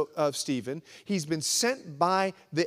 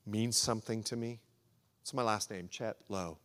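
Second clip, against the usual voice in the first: normal talking voice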